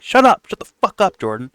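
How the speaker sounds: high, snappy pretend outburst; mock angry woman. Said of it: "She'd be like".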